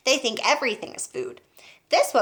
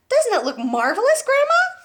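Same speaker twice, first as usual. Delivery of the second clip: excited call; 6 dB louder than usual. Acted. George calling to grandma.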